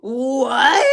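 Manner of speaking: loud mock outcry; shocked what. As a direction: act stunned by the ending.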